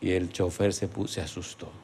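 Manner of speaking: low, fading almost to nothing; shrinking, very soft aside; cowed hush. Retold or imitated. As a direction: drop the voice right after the outburst. Narrates driver's fright, voice drops.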